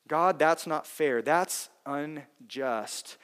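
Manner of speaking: slow, drawn-out murmured grievance; slow inner complaint. Retold or imitated. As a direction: voice the secret thought slowly. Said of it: "too scared to say it out loud".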